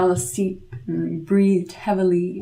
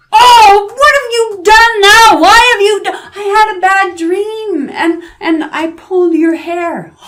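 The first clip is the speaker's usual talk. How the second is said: high, loud outraged cry; furious devil yell; 14 semitones higher, 9 dB louder than usual. A narrator's reading. Devil roaring at grandmother after second pluck. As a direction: howl in pain and anger.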